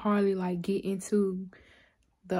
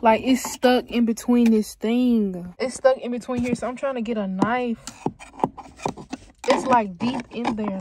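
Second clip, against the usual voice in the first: exasperated, louder; frustrated; 3 semitones higher, 10 dB louder than usual. Real, not acted. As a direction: complain loudly while digging for them. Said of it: "I am so upset".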